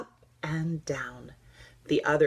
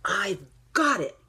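excited cry; 4 semitones higher, 4 dB louder than usual. Acted. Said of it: "he cried".

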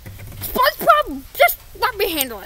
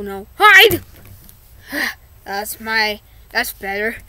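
ordinary talk, nothing marked

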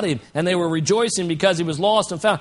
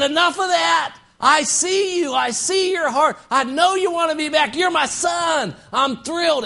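joyful father calling out; 9 semitones higher than usual. Retold or imitated. Father cuts off his returning son's speech, joyfully shouting. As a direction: cry out your welcome to the son. Father welcomes prodigal son.

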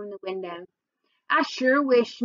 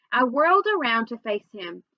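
usual speaking voice

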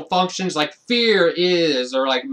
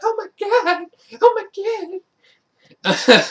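high, mock-terrified squeal; scared girls. Vlogger acting out the girls clinging to each other, with a high, mock-terrified squeal. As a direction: squeal it like two frightened girls. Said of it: "They're like".

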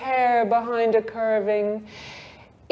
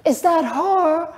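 curious question; 7 semitones higher than usual. A narrator's reading. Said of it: "asked Miss Kennedy".

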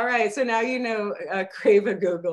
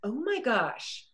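softer, drawn-out amazement; stunned recognition; 7 dB quieter, slower than usual. Acted. Woman recognizes someone from college, hushed slow surprise. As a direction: let it out slowly, taken aback.

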